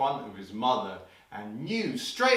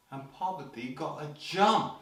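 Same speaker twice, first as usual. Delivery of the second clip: ordinary delivery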